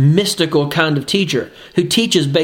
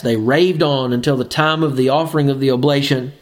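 ordinary talk, nothing marked

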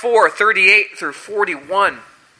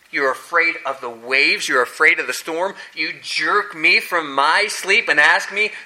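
ordinary talk

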